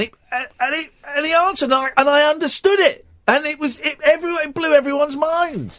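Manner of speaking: high-pitched, thrilled storytelling; amazed excitement. Real, not acted. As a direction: tell it in astonished delight, voice up high. Amazed retelling of being understood.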